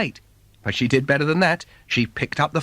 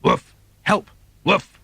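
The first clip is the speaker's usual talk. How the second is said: dog barking for help; 4 semitones higher, slower than usual. Acted. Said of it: "the bark called out".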